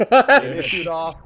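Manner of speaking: laughing out loud mid-line; loud laughing read. Real, not acted. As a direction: burst into laughter while reading. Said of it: "the book has lag issues".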